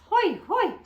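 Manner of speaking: high yelps; hot-hands yelp. Real, not acted. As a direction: shake off the heat. Fingers burned.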